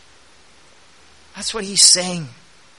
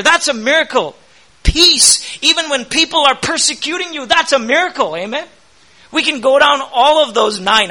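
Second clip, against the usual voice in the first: raised, insistent pulpit voice with punched words; fervent preaching; 5 semitones higher than usual. Acted. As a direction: drive the point home to the congregation. Preaching with rising fervour.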